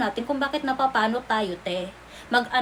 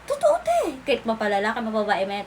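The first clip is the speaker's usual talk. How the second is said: normal talking voice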